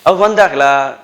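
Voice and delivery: sing-song Coimbatore Tamil question; Coimbatore dialect. Shown as a demonstration.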